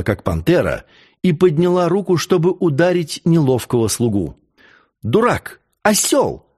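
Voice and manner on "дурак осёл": angry cry. A narrator's reading. Christine rebuking the clumsy servant, angry cry. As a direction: scold sharply with rising pitch. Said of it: "закричала она".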